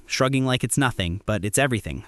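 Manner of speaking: usual speaking voice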